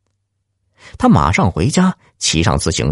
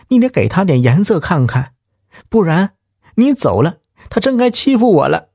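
higher, whining urge; pleading wife; 10 semitones higher than usual. Acted. Wife begs husband to teach the lout a lesson, high whine. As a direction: push him to act, voice climbing high.

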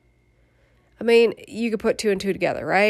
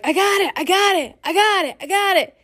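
high, tense I got it on repeat; frantic reassurance; 10 semitones higher than usual. Retold or imitated. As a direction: keep telling her you have control while fighting the wheel. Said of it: "I'm like".

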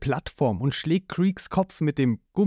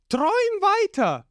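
put-on much higher, cheeky kid voice; high Ruffy voice. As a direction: taunt him like a cocky boy.

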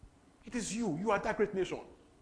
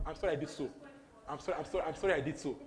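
ordinary delivery

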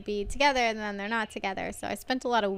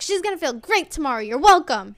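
fast, higher, then loud smug sign-off; smug dad; 4 semitones higher, 7 dB louder than usual. Retold or imitated. Dad hanging up on mom, smug and dismissive. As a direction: brush her off like a cocky dad. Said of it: "And he's like".

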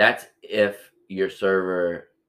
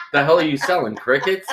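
higher and louder, disbelieving; incredulous shout; 8 semitones higher, 8 dB louder than usual. Retold or imitated. Mocking the cheap customers.

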